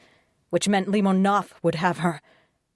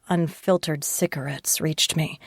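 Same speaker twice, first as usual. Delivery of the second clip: plain reading voice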